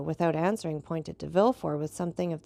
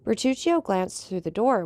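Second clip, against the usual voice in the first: ordinary delivery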